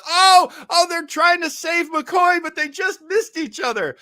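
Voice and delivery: high-pitched, loud viewer's lament; mock distress. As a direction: act out hammed-up dismay, starting loud. Acting out the anguished viewer.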